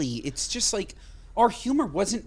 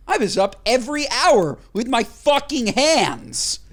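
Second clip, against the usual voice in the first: raised, higher-pitched exasperated complaint; 5 semitones higher, 5 dB louder than usual. Real, not acted. Griping to co-host about waking every hour, exasperated. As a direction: push the gripe out louder and higher.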